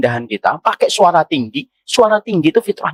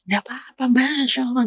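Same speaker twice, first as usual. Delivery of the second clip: soft gentle reply; 6 semitones higher, 6 dB quieter, faster than usual. Retold or imitated. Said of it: "Ada nggak orang yang bertengkar?".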